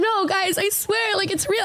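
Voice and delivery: high-pitched imitated pleading; insistent pleading. Retelling a girl insisting to her friends, pleading. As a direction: plead that it's real in a lifted voice. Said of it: "she's like".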